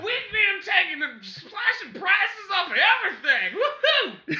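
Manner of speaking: slow, loud, high maniac pitch; unhinged villain. Pretend. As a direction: scream the deals like a madman. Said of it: "You sound like a madman".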